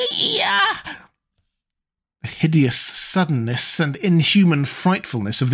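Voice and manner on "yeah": high wailing scream of fear; terrified shriek. A narrator's reading. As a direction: shriek in sheer horror. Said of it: "the old man's shriek".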